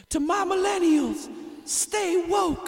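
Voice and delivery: put-on very high, slow, loud old-woman politician; mock old senator. Comic imitating an old California senator to millennials, high and loud. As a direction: cry it out like an elderly senator. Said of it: "she's always like".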